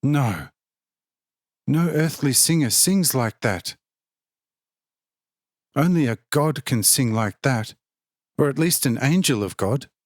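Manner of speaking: mildly rapturous, as if stunned by the tone; enthusiastic exclamation. A narrator's reading. Zinka marvelling to the crowd at the angel's song, mildly excited. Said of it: "Zinka exclaimed with great enthusiasm".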